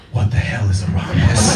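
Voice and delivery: whispered low panic; panicked inner whisper. Retold or imitated. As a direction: hiss it to yourself in a panic.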